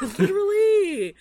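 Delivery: laughing loud yes-literally; laughing shout. Real, not acted. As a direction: crack up while agreeing. Laughing agreement.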